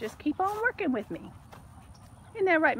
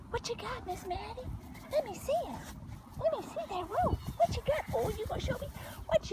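high and softer, cooing; 11 semitones higher, 6 dB quieter, faster than usual. Real, not acted. Owner softly coos at the puppy, high and hushed. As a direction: coax her gently in a high voice.

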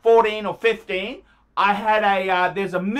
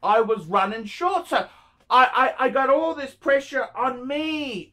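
usual speaking voice

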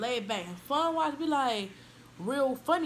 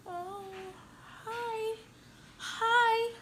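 mock shy girl, higher and mumbled; shy mumbling; 13 semitones higher, much slower than usual. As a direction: play the timid new kid, barely greeting. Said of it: "and you're just walking like".

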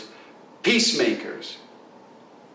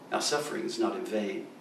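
much softer and lower, like voices on the wind; 6 semitones lower, 11 dB quieter, faster than usual. Acted. A choir of voices speaks to him, hushed. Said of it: "a choir of voices said to him".

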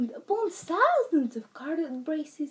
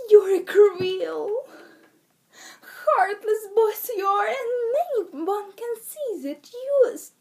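put-on weep the accusation to guilt the boy; pitiful fake crying; 7 semitones higher, 6 dB louder than usual. Leprechaun tricking Pet with tears, high sobbing wail. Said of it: "burst into pitiful tears".

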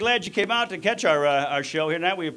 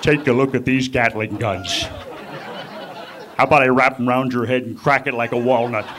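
lower, deliberate tough-guy wrestler boast; Jesse Ventura; 5 semitones lower than usual. Retold or imitated. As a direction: show off your arms like a cocky wrestler. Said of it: "There's Jesse looking at him like".

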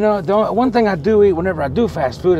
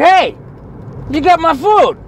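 loud, high confrontation; outraged customer claiming stolen food; pissed off; 10 semitones higher, 7 dB louder than usual. Retold or imitated. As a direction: call out an indignant accusation. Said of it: "I'm kinda pissed off".

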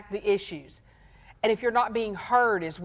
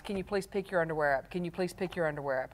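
weary nagging; 4 semitones lower, 7 dB quieter, faster than usual. Retold or imitated. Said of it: "maybe you've said fifty times".

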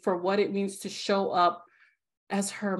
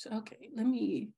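whisper to self; 5 dB quieter than usual. Real, not acted. Host collecting herself after crying.

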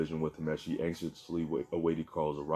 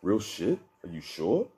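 voice cracking with excitement; 3 semitones higher, slower than usual. A narrator's reading. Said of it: "Carl's voice cracked with excitement".

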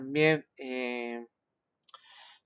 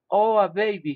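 slightly higher, louder storybook exclamation; a touch of excited surprise; mildly exclaimed cry; 4 semitones higher, 5 dB louder than usual. A narrator's reading. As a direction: read it as a happy discovery, lightly. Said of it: "he cried".

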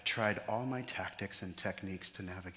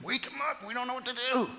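much higher panicked voice; mock panic; 3 dB louder, faster than usual. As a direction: squeak it out in a fluster.

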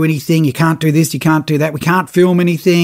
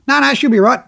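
breezy skipper; 8 semitones higher than usual. Retold or imitated. Cole brushes off safety worry.

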